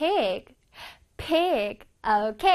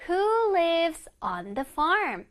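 slow model reading; 2 semitones higher, much slower than usual. Shown as a demonstration. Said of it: "teacher repeats the title".

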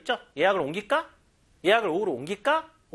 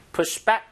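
"Push back" with "back" louder and rising; stress on back; 4 semitones higher, 4 dB louder than usual. Shown as a demonstration. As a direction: hit "back", not "push". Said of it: "구동사니까 강세 뒤에 가야 돼요".

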